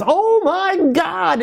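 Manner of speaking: high, drawn-out cry in an imitated Louisiana accent. Photographer heckling from the back of the room, mock Louisiana accent. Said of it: "in this Louisiana accent".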